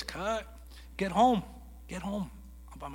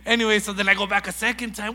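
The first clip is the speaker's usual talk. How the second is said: ordinary delivery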